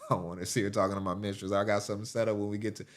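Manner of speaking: quick, hushed aside as a sneaky husband; secretive husband. Acted. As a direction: keep it down so the wife won't hear. Acts husband secretly texting mistress.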